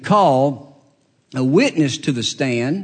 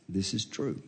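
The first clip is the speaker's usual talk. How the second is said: meek quiet answer; 7 semitones lower, 16 dB quieter than usual. Acted. Mary meekly answers the prosecutor.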